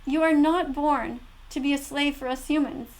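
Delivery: ordinary talk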